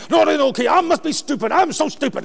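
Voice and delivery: mock furious self-blame; angry rant. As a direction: retell a frustrated driver berating himself. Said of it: "Oh, was I angry".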